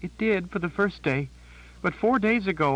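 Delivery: high, shaken voice of a terrified sister; a scared woman blurting out bad news; frightened Janet. A narrator's reading.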